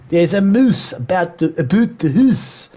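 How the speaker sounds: put-on overdone parody of a Canadian accent; mock Canadian accent. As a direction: ham it up like an American mocking Canadians. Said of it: "he really emphasises".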